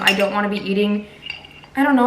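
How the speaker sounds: ordinary talk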